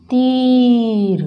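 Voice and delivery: very slow तीर with the ई held long; teacher stretching तीर; drawn-out long ई. Shown as a demonstration. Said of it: "थोड़ा सा खींच के बोलना है".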